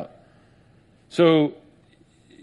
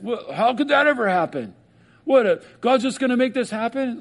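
doubting like Nicodemus; 7 semitones higher than usual. Retold or imitated. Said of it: "they'll be like Nicodemus".

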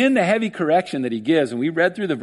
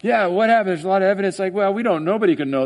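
higher mock courtroom voice; mock court skeptic; 4 semitones higher, faster than usual. Preacher mocks a clueless court official, higher. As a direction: play a clueless clerk. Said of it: "you come into court, like".

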